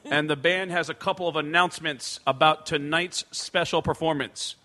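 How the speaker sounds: loud, booming arena announcement; stage announcer. Retold or imitated. Comedian posing as band staff addresses the crowd, announcer voice. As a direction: address a stadium crowd with authority.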